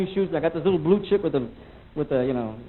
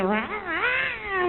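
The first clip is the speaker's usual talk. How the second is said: cat noise; 3 dB quieter than usual. Retold or imitated. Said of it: "just saying".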